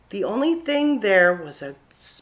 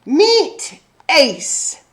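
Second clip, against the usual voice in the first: proud dad; 6 semitones higher, 6 dB louder than usual. Acted. Dad presents new fish.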